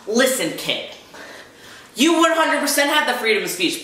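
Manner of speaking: high-pitched, insistent concession; worked-up telling-off; indignant retort. Real, not acted. Addresses the commenter in argument.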